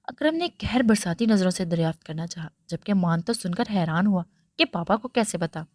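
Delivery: ordinary delivery, nothing marked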